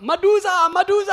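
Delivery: loud high alarmed call; crying out. Retold or imitated. Someone warning the bathers, loud high cry. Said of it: "somebody cries out".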